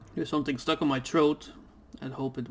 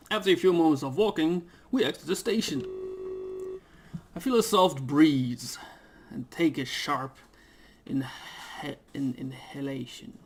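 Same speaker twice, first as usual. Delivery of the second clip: slightly raised, lightly performed reading; game narration read; 3 semitones higher than usual. Acted. Reading the visual novel aloud to viewers, light narration voice. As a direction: read the game text like a story.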